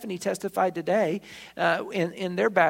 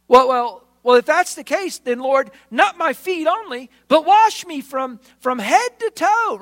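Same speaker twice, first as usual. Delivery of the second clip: high, loud, overeager rush; dense eager Peter; 7 semitones higher, 8 dB louder than usual. Acted. Peter begging Jesus to wash all of him, overeager and loud. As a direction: play him thick and too keen. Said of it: "demonstrating the density of his head".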